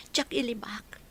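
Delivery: tearful whisper; grieving daughter whispering her sorrow. Acted. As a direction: breathe it out, barely voiced.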